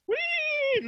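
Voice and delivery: high gleeful whee; playful whee. Pretend. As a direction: squeal it like a kid on a ride. Camera flying like a pig.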